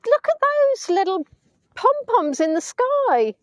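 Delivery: high, excited cooing at the sky; squealing with delight at the sunset clouds. Real, not acted. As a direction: gush over the pom-pom clouds.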